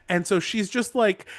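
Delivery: ordinary delivery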